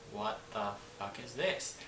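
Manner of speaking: slow, soft retold bewilderment; hushed disbelief. Finding herself in a strange room, hushed disbelief. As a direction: draw it out under your breath.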